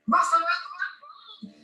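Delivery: high startled Gruffalo cry on a playback; recorded frightened monster line from the laptop; scared Gruffalo played back. Teacher plays back the Gruffalo's line, startled cry on a recording. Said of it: "quite shocked and quite scared".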